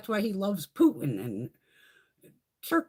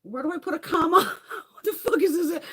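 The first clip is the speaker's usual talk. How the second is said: mock baffled typist, higher and louder, breaking into a laugh; confused transcriptionist; 7 semitones higher, 5 dB louder than usual. Imitating a transcriptionist baffled by rambling speech, laughing. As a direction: play the lost transcriptionist, laughing in disbelief. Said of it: "I feel bad for the transcriptionist".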